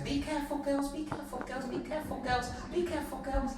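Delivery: high, soft, fussy repeated warning; posh worried grandmother. Retold or imitated. Kids imitate posh grandmother fretting over the herd, posh worried voice. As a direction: flutter it softly in a high posh voice. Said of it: "who's a bit more posh".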